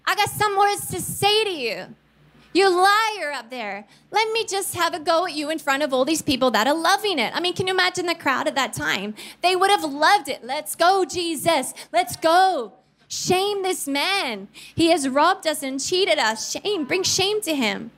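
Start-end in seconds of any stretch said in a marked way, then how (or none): 0.0-7.3 high, scolding public call-out; mock call-out. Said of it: "he could have said". 10.4-17.9 high, egging-on jeers; mock crowd chanting shame; jeering crowd.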